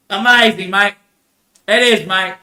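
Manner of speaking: usual speaking voice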